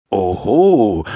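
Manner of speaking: lightly voiced jovial old doctor; a hint of a comfortable old man, amused; cosy old man's voice. A narrator's reading. Old doctor greets a waking patient, lightly cosy old voice. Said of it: "sagte eine gemütliche Altmännerstimme".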